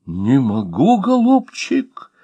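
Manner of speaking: put-on slow, high, pleading old gentleman; terrified babble. A narrator's reading. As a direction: babble it out, lips trembling with fear. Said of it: "пролепетал мирный господин бледными трясущимися губами".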